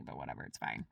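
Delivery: resigned under the breath; muttered hush. Real, not acted. Sudden hush after outburst.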